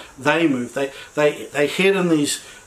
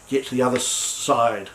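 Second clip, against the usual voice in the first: usual speaking voice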